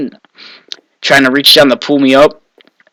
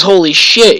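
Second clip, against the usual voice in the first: high, startled exclamation; shocked stranger; 6 semitones higher than usual. Retold or imitated. Stranger comes upon swimmer below the dam, startled. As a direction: imitate a passer-by taken aback.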